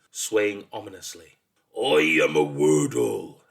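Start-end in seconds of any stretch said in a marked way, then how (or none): 1.8-3.4 low, slow, loud bellow; bellowing monster. A narrator's reading. Monster introducing itself to a boy, bellowing. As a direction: bellow ominously from the depths. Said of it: "it bellowed".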